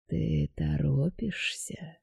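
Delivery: soft, slow insinuating voice; luring spirit whisper. A narrator's reading. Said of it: "Вкрадчивый голос подбирался всё ближе".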